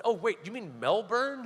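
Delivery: "Melbourne" said with a clear R, Mel-bern; mock dawning, "Melbourne" with the R sounded; mock realization. Comedian mocks his buddy's Australian Melbourne, mock realization. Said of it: "There's a R in Melbourne".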